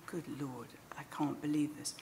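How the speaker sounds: low, very soft awe; retold stunned thought, said to oneself; hushed disbelief. Retold thought on seeing purple violets.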